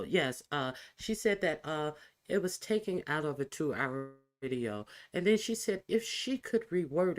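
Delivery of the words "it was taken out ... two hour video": usual speaking voice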